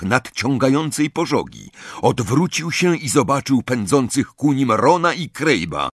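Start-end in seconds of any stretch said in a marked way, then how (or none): none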